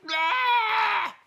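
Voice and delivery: high, loud shocked yelp; startled scream. Acted. Walrus shocked.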